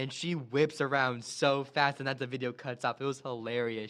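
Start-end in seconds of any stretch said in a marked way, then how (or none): none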